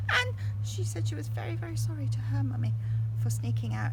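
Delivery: plain reading voice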